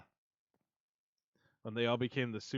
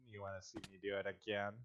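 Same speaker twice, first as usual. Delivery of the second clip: inner goofy voice; 5 semitones lower, 7 dB quieter, faster than usual. Retold or imitated. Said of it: "there was a part of me that went".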